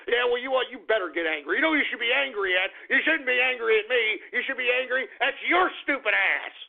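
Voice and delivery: usual speaking voice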